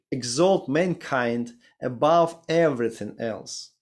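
ordinary talk

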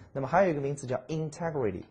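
ordinary delivery

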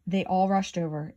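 ordinary delivery

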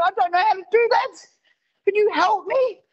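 high-pitched, helpless husband voice; meek helplessness. Retold or imitated. Husband asks wife to help dim Kindle, comically helpless.

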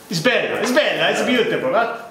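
playful mock-Italian rapture; hammed-up Italian enthusiasm. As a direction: gush like an Italian food lover. Host hams up Italian dish praise.

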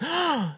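sharp soft gasp of wonder; dramatic gasp. Acted. Preacher gasping in awe at seeing God's face. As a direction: gasp as if the veil just lifted. Veil removed, seeing God.

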